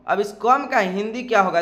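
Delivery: ordinary delivery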